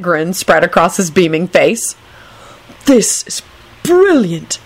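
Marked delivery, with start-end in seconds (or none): 2.9-4.6 high, delighted and emphatic; happy high-pitched marvelling; enthusiastic. A narrator's reading. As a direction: gush with stoned enthusiasm. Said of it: "he told Kingsley enthusiastically".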